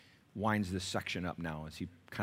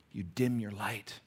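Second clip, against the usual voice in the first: ordinary delivery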